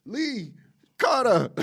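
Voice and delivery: high, loud shouted names; Rush Hour shout. Pretend. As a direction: yell the names like the movie duo. Rush Hour skit impression.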